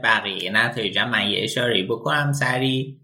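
normal talking voice

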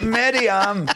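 high, laughing cry of disapproval; mock shock. Scolding his co-star for a rude remark, mock shock. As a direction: call out her name, pitched high, scandalised.

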